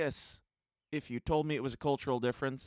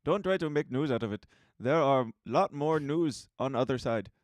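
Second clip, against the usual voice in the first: put-on Indian accent; 3 semitones higher, 6 dB louder than usual. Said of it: "I should do it in Indian voice".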